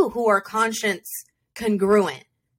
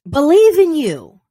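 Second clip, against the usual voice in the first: "Believe in you" louder and higher, falling hard on "Believe"; emphatic repeat; 6 semitones higher, 8 dB louder than usual. Shown as a demonstration. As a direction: punch "Believe" with force and drop the pitch. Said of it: "you don't believe in you".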